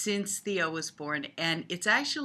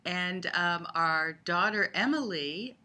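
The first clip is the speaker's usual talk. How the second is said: ordinary talk, nothing marked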